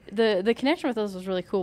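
ordinary talk

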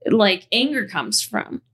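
ordinary talk, nothing marked

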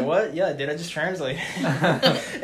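laughing. Retold or imitated. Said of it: "he's like".

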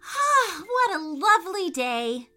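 high bright morning joy; cheerful little girl. Acted. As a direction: greet the day with a happy sigh. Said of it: "said Ryan, stretching out her arms".

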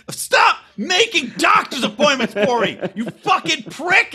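high-pitched, drawn-out comic yelling; hammed-up outrage, each word stretched; mock furious neighbor. Acted furious neighbor quote.

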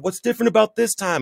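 high, strained mock scream at moderate volume; retold screaming. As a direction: re-enact yelling desperately at God. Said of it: "I'm screaming and I'm yelling at God".

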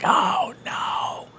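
hushed, slow anguished whisper; guilty whisper. Retold or imitated. Child whispering to mom, crushed by guilt. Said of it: "And you're like".

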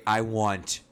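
entitled customer. Pretend. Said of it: "And I'm making a scene".

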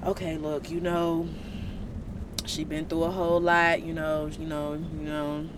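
gentle intercession. Retold or imitated. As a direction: ease it down, interceding for them. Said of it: "He up there sitting at God's right hand like".

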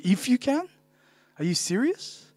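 acting out a quietly affronted comeback; triggered, incredulous. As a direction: throw it back in disbelief, rising at the end. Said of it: "He was, like, so triggered".

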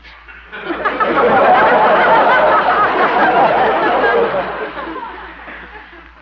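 long, high, loud tickled giggle. Real, not acted. [name] laughing at his pigeon joke, loud high giggle. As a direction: laugh hard at your own wisecrack.

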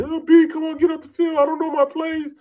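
hammed-up crying teammate; mock crying plea. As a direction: imitate a panicked teammate begging through tears. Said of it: "he's crying".

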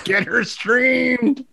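slow, drawn-out and high; slow catchphrase. Acted. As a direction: stretch each word out like a catchphrase. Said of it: "everything I say is gonna end with "Get her streamed".

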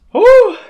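sudden high whoop from the heat. Real, not acted. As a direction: yelp out at the burn. Reacting to hot wing.

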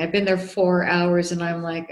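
usual speaking voice

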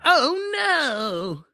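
high, loud cartoon wail; hammed-up cartoon dismay; Care Bear. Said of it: "Did you like that impression".

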